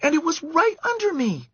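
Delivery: put-on high girlish voice, insistent. A narrator's reading. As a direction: camper sure it was a mouse. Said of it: "said Karen".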